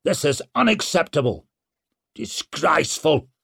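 put-on old lord's indignant growl; gruff, affronted protest. A narrator's reading. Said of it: "growled Clencher".

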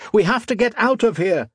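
high, urgent shout. A narrator's reading. Modo calling to escape the falcons, high urgent shout. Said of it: "Modo shouted".

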